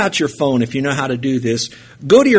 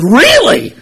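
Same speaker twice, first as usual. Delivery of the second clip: surprised; 14 semitones higher, 8 dB louder than usual. Retold or imitated. Girl says she's from Albania.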